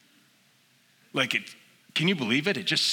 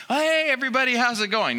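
high, breezy mock greeting; carefree call; 10 semitones higher than usual. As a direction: play the fearless guy waving from the railing. Mock carefree guy at railing.

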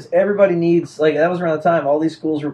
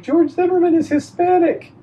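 retold indignant protest, pitched way up; high-pitched protest; 13 semitones higher than usual. Retelling his protest to the yellers.

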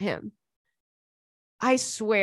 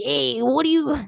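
loud, higher New Jersey mobster voice; Tony Soprano impression; 5 semitones higher, 4 dB louder than usual. Drunk at the bar, talking like a New Jersey mobster. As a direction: play a New Jersey Italian-American wiseguy. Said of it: "like a guy from The Sopranos".

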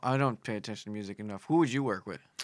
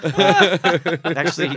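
laughing through the word; 6 semitones higher, 12 dB louder, much slower than usual. Real, not acted. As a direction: break up laughing. Laughing after the impression.

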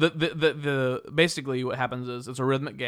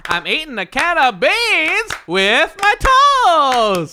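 loud, high, drawn-out singer impression; 13 semitones higher, 9 dB louder, much slower than usual. Player improvising an answer in the game, hollered impression. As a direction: holler it slowly in rhythm. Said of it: "an impersonation of [name]".

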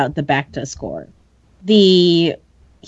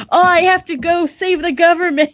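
dramatic excuse; 10 semitones higher than usual. Pretend. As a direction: play it up as a heroic exit. Hammed urgent excuse.

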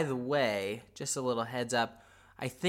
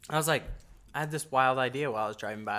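usual speaking voice

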